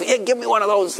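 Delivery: put-on high childish pleading; imitating a grabby child; eager child. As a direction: beg like a little kid. Kids wanting palm leaves.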